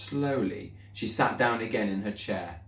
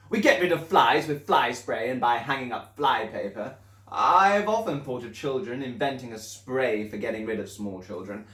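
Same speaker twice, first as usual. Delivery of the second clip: put-on loud, higher, booming headmistress sneering at children; Trunchbull voice; 4 semitones higher, 3 dB louder than usual. Headmistress sneering to the class about children.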